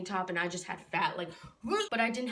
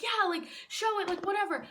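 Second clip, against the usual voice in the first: higher-pitched mock chorus of agreement; classmates' eager reply; 10 semitones higher than usual. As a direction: lightly imitate keen classmates, pitched up. Classmates eagerly answering the teacher.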